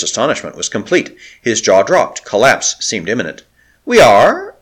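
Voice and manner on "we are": high, loud stunned question; gasped. Acted. O'Keefe to Lakla, gasping in shock. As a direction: gasp it out in shock. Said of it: "he gasped".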